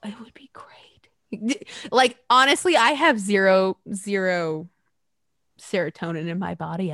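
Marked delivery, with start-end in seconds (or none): 0.0-1.7 slow savoring whisper. Real, not acted. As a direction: breathe it out slowly with relish. Savoring Trump being dragged out.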